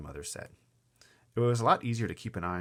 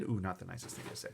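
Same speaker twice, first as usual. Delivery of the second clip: ordinary delivery, nothing marked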